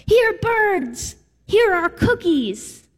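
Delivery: high, loud call to the birds, last words slowed; sunny Frog calling the birds; cheerful yell. Retold or imitated. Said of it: "and he yells".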